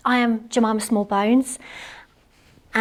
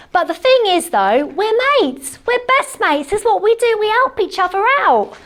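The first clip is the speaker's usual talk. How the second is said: high and bright about her mate; proud and fond, pitched up; gushing; 10 semitones higher, 4 dB louder than usual. Real, not acted. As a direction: gush about your best friend.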